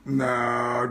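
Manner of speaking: usual speaking voice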